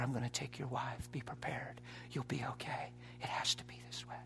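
hushed, near-whispered imitation of a sly spirit; deceiving inner voice. Preacher retelling what he heard in the bedroom, deceiving inner voice. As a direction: softly imitate a voice heard in prayer. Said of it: "I heard this voice saying".

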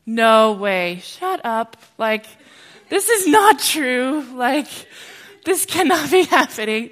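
can't believe what you're seeing; stunned disbelief. Retold or imitated. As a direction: speaker reliving shocked disbelief at a healing. Speaker retells own disbelief.

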